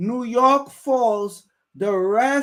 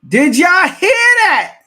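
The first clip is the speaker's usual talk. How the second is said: loud and high, can't believe what he heard; excited shout; 8 semitones higher, 10 dB louder than usual. Real, not acted. Streamer shouting at his viewers in disbelief, loud and high.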